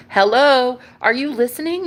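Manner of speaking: hello, snap them back to you; impatient call-out. Retold or imitated.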